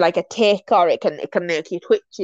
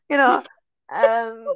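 ordinary talk

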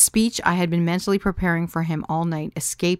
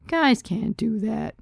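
slow, higher, plain statement of fact; matter-of-fact; 5 semitones higher, slower than usual. A narrator's reading. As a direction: state it as a simple given, slowly. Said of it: "Ben said matter-of-factly".

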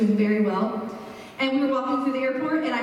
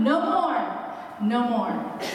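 "No more" with MORE swooping up and held, then repeated falling; emphatic tone; much slower than usual. Retold or imitated. Showing how native speakers say it, emphatic "no more". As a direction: imitate an emphatic "no more", stretched out. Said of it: "the same emphatic tone".

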